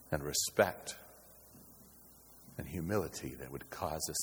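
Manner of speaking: low, hushed, measured preaching; quiet, weighty close; solemn reverence. Real, not acted. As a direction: bring it down soft and low, with long pauses. Solemn, reverent close after the climax.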